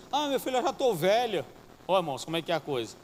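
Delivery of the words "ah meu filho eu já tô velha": mother complaining. Retold or imitated. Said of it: "chegou pra mim chorando".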